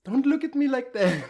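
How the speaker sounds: bursting into embarrassed laughter while protesting; flustered laugh. Acted. Flustered by partner's look.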